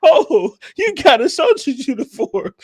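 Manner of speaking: retelling the robot-costume joke, laughing; laughing quote. Retold or imitated. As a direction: quote him while still cracking up.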